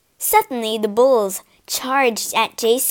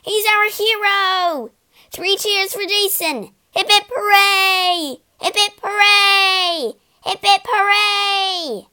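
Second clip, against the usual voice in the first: cheering; 10 semitones higher, 4 dB louder than usual. Acted. Argonauts cheering Jason after the battle, high cheer. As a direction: cheer him on like a crowd. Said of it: "cheered the Argonauts".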